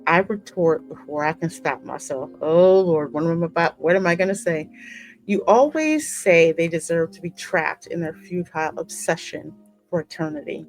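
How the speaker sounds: normal talking voice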